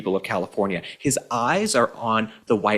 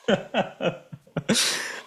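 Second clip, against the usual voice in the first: mocking laugh; 8 semitones higher, 4 dB quieter than usual. Real, not acted. Mocking the governor's clip.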